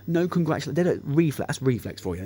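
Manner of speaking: ordinary delivery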